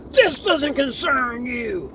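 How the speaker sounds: slow, high mock bellow; imitated yelling cop. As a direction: play an angry cop shutting someone down. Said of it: "he just yelled at me".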